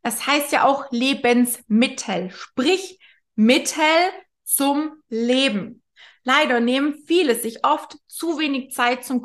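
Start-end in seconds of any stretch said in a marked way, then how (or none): 2.3-5.8 "sprich Mittel zum Leben" said very slowly, word by word; drawn-out teaching stress; slow, spelled-out emphasis. Shown as a demonstration.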